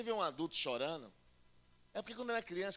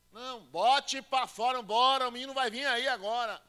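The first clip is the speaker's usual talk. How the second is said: loud, higher, slow urging to push; midwife shouting; 6 semitones higher, 11 dB louder than usual. Retold or imitated. Midwife shouting at a woman in labour to push. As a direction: yell at her to push the baby out.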